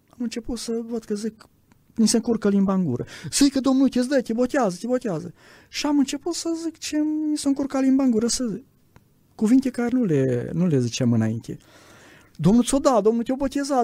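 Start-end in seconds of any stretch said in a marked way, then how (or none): 3.3-5.3 high, quick-fire coaxing into his ear; excited urging. Retold or imitated. Believers pressing the speaker to pray, high excited coaxing. Crowd urging him in prayer. 12.3-13.8 high, quick-fire coaxing into his ear; excited urging. Retold or imitated. Believers pressing the speaker to pray, high excited coaxing. Crowd urging him in prayer.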